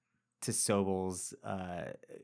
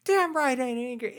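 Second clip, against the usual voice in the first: much higher, louder mock shriek; mock furious yell; 7 dB louder than usual. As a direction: scream it in denial. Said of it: "she's like".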